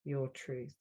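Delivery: measured delivery, leaning on "so sorry"; guided healing affirmation. Acted. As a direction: offer each apology as a healing phrase. Leads healing phrases with long pauses.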